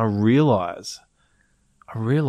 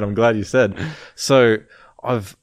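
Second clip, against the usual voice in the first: usual speaking voice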